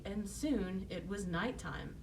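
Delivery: ordinary delivery, nothing marked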